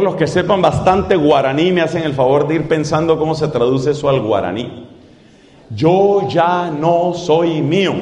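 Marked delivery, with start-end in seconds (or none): 5.7-7.9 slow, low, deliberate words; slow emphatic. Shown as a demonstration. Preacher to congregation, slow solemn phrase. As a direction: lay each word down slowly. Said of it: "Son cinco palabras".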